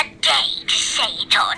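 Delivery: usual speaking voice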